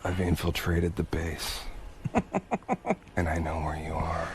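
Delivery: low, very soft covert phone call; super spy. Pretend. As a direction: murmur it like a spy in hiding. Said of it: "I pretend I'm a super spy".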